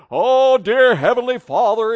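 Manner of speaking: hammed-up grand opening of a prayer; formal King James prayer. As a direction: intone it like an old-style formal prayer. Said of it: "sounds like it's prayed right out of the K J V".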